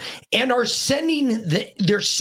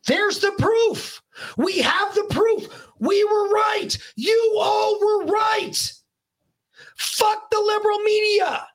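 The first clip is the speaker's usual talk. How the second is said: triumphant shout; 13 semitones higher than usual. Real, not acted. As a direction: crow it out like you won. Triumphant vindication rant to listeners.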